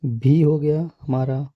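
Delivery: ordinary talk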